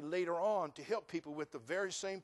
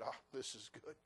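sarcastic; 3 semitones lower, 11 dB quieter than usual. Retold or imitated. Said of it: "You think I saw all that and said".

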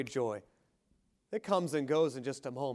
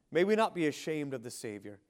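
normal talking voice

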